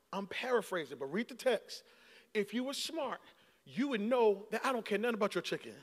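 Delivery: soft, gentle rebuke; quiet and steady, like a patient teacher; calm Jesus. Retold or imitated. Jesus gently correcting Martha, soft and calm. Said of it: "He says".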